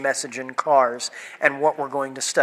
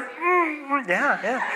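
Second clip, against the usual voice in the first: choked-up admitting; 10 semitones higher, 5 dB quieter, slower than usual. Retold or imitated. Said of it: "And I was like".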